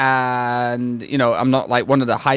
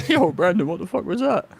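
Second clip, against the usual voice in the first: laughing; 4 semitones higher than usual. Retold or imitated. Laughing coach quoted teasing player.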